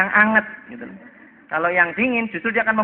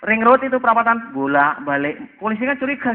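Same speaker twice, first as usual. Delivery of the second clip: normal talking voice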